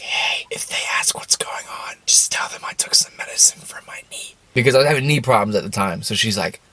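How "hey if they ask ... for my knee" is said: breathy whisper, no voice at all; conspiratorial whisper to your mother; retold whisper. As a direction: whisper it secretly to mom. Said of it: "What I thought was whispers".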